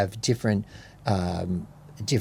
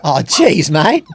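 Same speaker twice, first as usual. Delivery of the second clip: loud, higher, broad Queensland exclamation; Queenslander accent; 5 semitones higher, 7 dB louder, slower than usual. Pretend. Answering a challenge to speak like a local, mock Queenslander accent. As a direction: put on a hammed-up Queenslander accent. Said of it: "speaking like a Queenslander".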